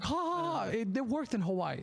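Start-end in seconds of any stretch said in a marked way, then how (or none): 0.0-0.6 high mock crowd laugh; imitated audience laugh. Comic showing how Hawaii took the joke, mock laughing. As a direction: mimic an audience cracking up, high-pitched. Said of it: "people were like".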